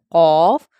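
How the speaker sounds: long, heavy قاف; thick, bold letter. Shown as a demonstration. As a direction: say قاف full as "qawf". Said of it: "اس کو موٹا پڑھا جاتا ہے".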